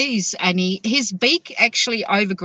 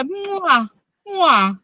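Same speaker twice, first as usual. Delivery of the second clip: kiss sounds; 6 semitones higher than usual. Retold or imitated. Parrot blowing kisses imitated.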